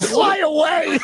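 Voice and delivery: high hammed-up yell, laughing; mock shout. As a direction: belt it out as a mocking wail. Mocking quote with laughter.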